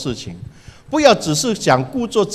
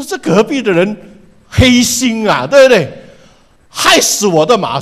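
a hammed-up angry neighbor, louder and higher; mock anger; 5 semitones higher, 6 dB louder than usual. Man blaming his neighbor for the dead horse, mock angry. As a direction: accuse him, half-playful fury. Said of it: "他就很生气".

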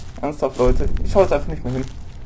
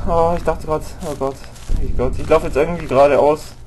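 ordinary talk

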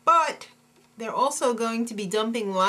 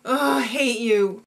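mock tearful outburst; slow, whiny cry of hatred; Jessie; 3 dB louder than usual. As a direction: overact a sulky wail. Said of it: "that Jesse's crazy".

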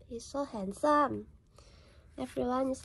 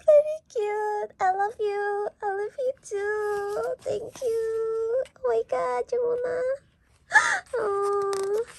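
fan gushing; 8 semitones higher, 4 dB louder than usual. Real, not acted. Fan thanking Jongwon's message, adoring squeal. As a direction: gush at your idol in a high voice.

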